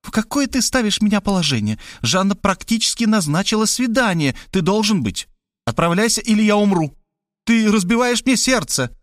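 guilt him like a theatrical mother; mother's dramatic plea. A narrator's reading. Mother guilting her son into the date, high and wounded.

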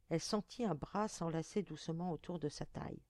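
plain reading voice

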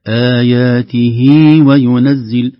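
"آياته" with the final ha vowel drawn out, slow and loud; tajweed demo. Shown as a demonstration. Teacher showing viewers the extra ya in "آياته", slow and loud. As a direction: stretch the ha of "آياته" for two counts. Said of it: "علامة عن ياء ... زائدة".

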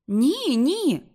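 high, loud double no; exclaiming. Acted. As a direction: cry out against the idea. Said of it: "вигукнула Рут". (Рут вигукує, високо й голосно)

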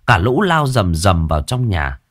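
usual speaking voice

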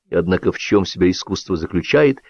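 ordinary delivery

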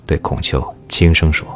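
ordinary delivery

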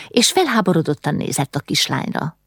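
ordinary delivery, nothing marked

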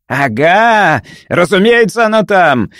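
high, triumphant exclamation; triumphant exclaim. Acted. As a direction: crow it like you just won. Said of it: "с победным видом воскликнул".

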